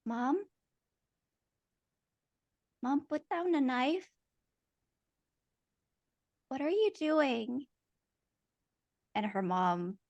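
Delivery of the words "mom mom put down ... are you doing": put-on higher, hushed little-girl voice; a scared child pleading with her mother; frightened child. Child Ashley begging her mother, frightened and small. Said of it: "she sees her mother".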